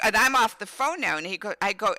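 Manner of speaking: usual speaking voice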